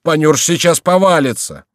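high, loud alarm; distressed, pleading yell; crying out. A narrator's reading. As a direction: shout a warning to make him stop. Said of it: "кричал Горанфло".

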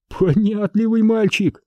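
high, slow patronising praise; wry amusement. A narrator's reading. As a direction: drawl mock praise for a quick learner. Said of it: "хмыкнул Самсонов". (насмешливая похвала врастяжку)

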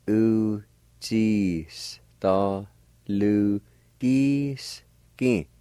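Uchistalugiski stretched very slowly, syllable by syllable. Shown as a demonstration. Teacher demonstrating Uchistalugiski for learners, very slow and broken up. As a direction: draw out each syllable of Uchistalugiski separately. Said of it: "pronounce them out kinda slow".

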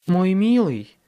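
sweet fairy godmother voice; tenderly. Acted. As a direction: coo it to him. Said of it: "нежно произнесла она".

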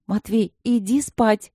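slow, mildly stern order. A narrator's reading. Алина велит Матвею идти спать, строго и медленно. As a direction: send him to bed, a touch strict. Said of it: "строго говорила она".

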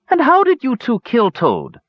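high, sceptical elf voice; incredulous elf. A narrator's reading. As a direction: question the boast with lofty disbelief. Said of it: "a fair eyebrow arched incredulously".

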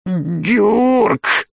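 high, choked call; strangled cry. A narrator's reading. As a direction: call the name through a squeezed throat. Man choking calls out.

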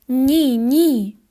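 cried out in shock; a sudden cry of horror; horrified cry. A narrator's reading. Narrator seeing Sam on the ground, crying out in horror.

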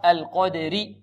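ordinary talk, nothing marked

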